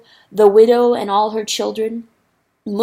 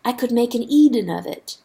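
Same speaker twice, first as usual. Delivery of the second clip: ordinary delivery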